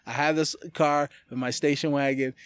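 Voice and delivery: normal talking voice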